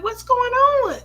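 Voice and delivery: high, loud alarmed question; retold alarm. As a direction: cry it out like a startled mom. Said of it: "They scared the heck out of me".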